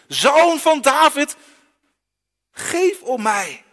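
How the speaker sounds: loud, high, very slow cry. Acted. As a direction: shout even harder over the crowd. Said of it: "Hij gaat alleen maar harder roepen".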